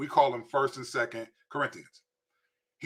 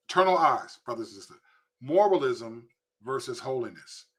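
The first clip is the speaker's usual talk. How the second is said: ordinary talk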